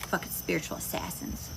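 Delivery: hushed, breathy whisper under the breath; whispering an aside. Real, not acted. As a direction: drop to a secret whisper. Tarot reader aside after pause.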